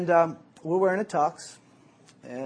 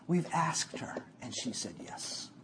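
unvoiced, much softer, trailing lower; excited whisper; 5 semitones lower, 8 dB quieter than usual. Guy confiding his big news, whispered. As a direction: share a thrilling secret under the breath.

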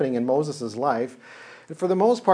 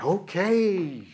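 meek resignation; 5 semitones higher than usual. Acted. Moses meekly giving in.

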